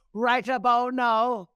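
loud, high announcer-style hype call; hyped catchphrase. Retold or imitated. Host cueing a fighter's catchphrase, hyped. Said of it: "because we say this sometime".

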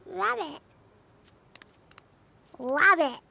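usual speaking voice

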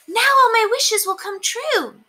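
thrilled little mouse; excited cry. A narrator's reading. Mouse finding the wishing well, high and excited. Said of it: "she cried".

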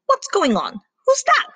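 alarmed, pitch leaping on the second question; startled awake. Acted. Cow woken by an intruder, startled. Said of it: "woke up with a start".

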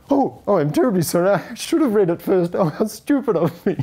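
high, fast, loud gushing sorry; mock the publicist's panicked over-apology; imitating a flapping, embarrassed publicist; flustered apology. Publicist flustered apology, snatching review.

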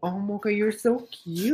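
soft, high pet talk; cooing. Real, not acted. Owner adoring Mocha the pet, cooing. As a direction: coo softly, stretching the last word.

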